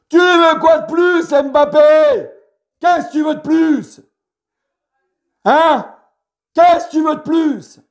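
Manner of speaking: exasperated rant. Real, not acted. Fan berating Mbappé over his sulking, exasperated shout. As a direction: demand it of him, fed up. Ranting at Mbappé about attitude.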